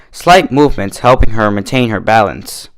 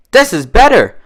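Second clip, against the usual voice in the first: high, louder praise; delighted; 12 semitones higher, 5 dB louder than usual. A narrator's reading. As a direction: savour it with glee. Dash delighted by cider.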